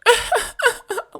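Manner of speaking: high, loud mock cackle into the mic; imitated obnoxious laugh. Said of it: "she would just keep laughing and laugh into the microphone".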